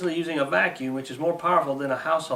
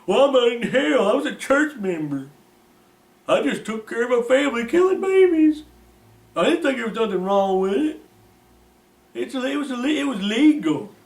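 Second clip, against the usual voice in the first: whining damned man; 9 semitones higher, 7 dB louder than usual. Retold or imitated. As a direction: wail it like a confused sinner begging. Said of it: "he'd probably say".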